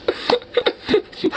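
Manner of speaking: silent, airless laughing fit; real laughing fit; high wheezing laugh. Real, not acted. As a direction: squeal of helpless laughter. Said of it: "I'm starting to cramp up".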